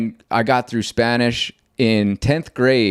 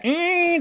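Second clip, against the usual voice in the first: high squealing "eh" like a crying tool; imitated tool whine. To a coworker, imitating the crybaby tool, high squeal. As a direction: mimic the multi-tool's shrill cry. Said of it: "that sound".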